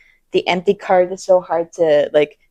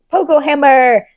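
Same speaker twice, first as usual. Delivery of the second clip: excited; 8 semitones higher, 8 dB louder than usual. Real, not acted. Excited announcement.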